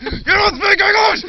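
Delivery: very high, loud screaming yell; dad screams at the driver; furious shout. Retold or imitated. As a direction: scream it with rage.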